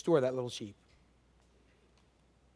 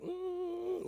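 dazzled sheep hum; 11 dB quieter than usual. Pretend. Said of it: "they'll be like".

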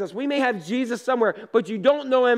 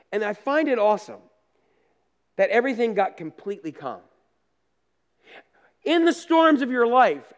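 normal talking voice

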